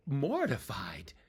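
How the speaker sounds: ordinary delivery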